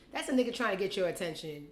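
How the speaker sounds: normal talking voice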